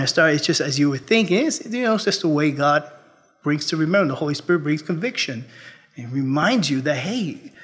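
ordinary talk

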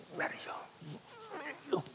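put-on sulking child. Boy facing his mom, soft pouty sulk. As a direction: mumble it like a sulky little kid. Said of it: "I tell her, make those faces".

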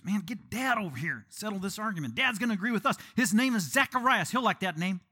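high, insistent mock argument; arguing relatives. Relatives argue, acted out.

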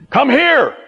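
high, sharp call of command; ordering a dog. Acted. Owner calls the dog over, high firm order. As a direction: summon a disobedient pet. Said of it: "like we say to our dog".

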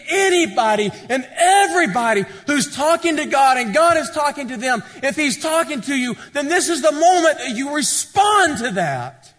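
high, loud, urgent invitation from the pulpit; full-force preaching. Real, not acted.

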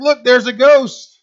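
loud, slowed mock-terrified shout of a disciple; terrified cry. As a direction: yell it like you've just seen a ghost. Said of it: "and they freak out".

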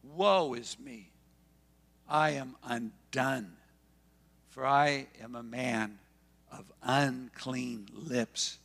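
slow, heavy woe is me; slow lament. Retold or imitated. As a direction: drag out each phrase like a broken man. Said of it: "he cried out".

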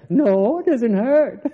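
loud, high mock denial into laughter; hammed-up denial. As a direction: insist far too much, then laugh. Acting pupil denying pain, laughing.

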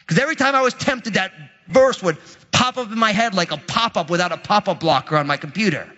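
ordinary talk, nothing marked

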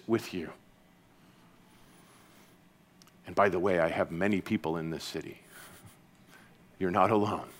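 soft, hushed promise of presence; gentle voice. Acted. Jesus speaking to a fearful listener, softly reassuring. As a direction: quietly comfort someone who is afraid. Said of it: "in a gentle voice".

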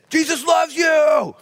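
high, loud, slow shout; shouting after a famous athlete; shouted blessing. Retold or imitated. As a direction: call it out across the crowd. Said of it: "And I said".

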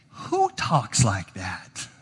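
slow, low rhetorical disbelief; incredulous. Real, not acted. Reacting in disbelief to grandiose claim.

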